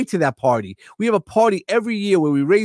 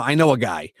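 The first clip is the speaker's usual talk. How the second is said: put-on a light New York accent, lower; playful New York accent, lower; 7 semitones lower than usual. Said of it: "When I say it in the New York accent".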